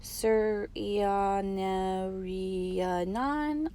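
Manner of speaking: Suryananan drawn out very slowly, syllable by syllable. A narrator's reading. Boy helping teacher with his name, very slowly. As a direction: spell Suryananan out slowly with your voice. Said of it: "I say slowly".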